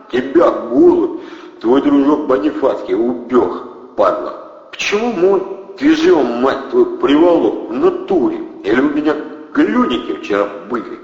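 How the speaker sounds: loud, high gangster bark; thug menace. A narrator's reading. Valery berating Andrey about Bonifatsky, thuggish bark. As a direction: jeer at him like a street tough.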